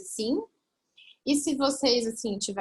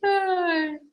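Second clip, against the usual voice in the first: high, falling, emotional "ai"; tearful sigh; 8 semitones higher, 3 dB louder than usual. Real, not acted. Mother composing herself after a moving memory, real tearful sigh. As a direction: let out a long moved sigh.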